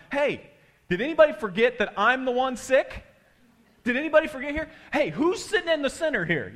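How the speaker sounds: high, loud, mock-outraged questions; indignant protest. Sick man ignored in the prayer circle, mock indignation. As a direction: call out like someone who's been forgotten.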